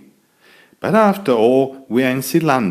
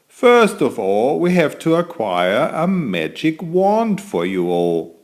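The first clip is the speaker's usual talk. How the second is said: higher, louder, grand wizard voice; master wizard; 7 semitones higher, 4 dB louder than usual. Acted. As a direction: welcome the class like a proud old wizard. Wizard addresses new students.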